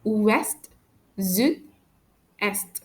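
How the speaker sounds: French "Ouest, sud, est" with final T and D sounded; pronounced final consonants. Shown as a demonstration. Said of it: "these three words are some exceptions".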